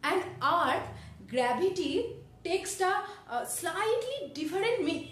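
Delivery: very slow, word-by-word English definition; textbook recitation. Shown as a demonstration. Student showing sir how gravity sounds in English, laboured textbook recitation. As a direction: recite it haltingly, stretching each phrase out.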